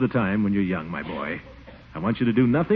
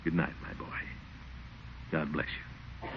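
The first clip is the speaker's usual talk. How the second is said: much softer, lower and hushed; tender goodnight; 6 semitones lower, 14 dB quieter than usual. Acted. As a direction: bless the boy gently as he sleeps. Uncle tucking boy in.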